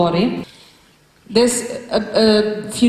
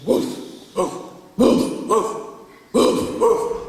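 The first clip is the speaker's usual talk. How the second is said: breathy barked woof; short doggy woofs; much slower than usual. Shown as a demonstration. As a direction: bark like a dog. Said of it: "you imagine being a dog".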